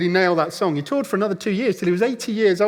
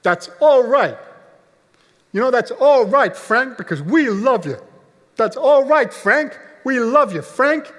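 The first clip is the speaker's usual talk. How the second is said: loud, high-pitched supportive shout; 8 semitones higher, 6 dB louder, slower than usual. Retold or imitated. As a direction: call out to the stage from the back of a hall. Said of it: "he just shouted out".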